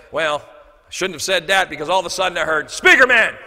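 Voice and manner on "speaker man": loud, high shout to get attention; hollered call. Retold or imitated. Man calling the speaker across the lobby, loud holler.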